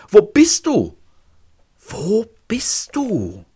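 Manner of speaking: high, drawn-out anguished question, the second very slow and fading; a last, dying call, very slow; desperate plea. Acted. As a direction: stretch the final plea out as strength fails. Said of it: "Gequält presst er die Worte".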